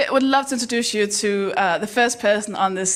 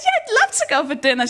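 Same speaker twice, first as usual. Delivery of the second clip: high, quick, gushing yes; eager acceptance; 9 semitones higher, faster than usual. Acted. Accepting her best friend's dinner invitation, gushing. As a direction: accept in a delighted rush.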